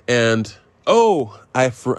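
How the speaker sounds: usual speaking voice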